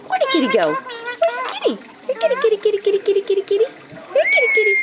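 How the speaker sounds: high sing-song call for the kitty; high cat-calling voice. Acted. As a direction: coax the cat out, high and sweet.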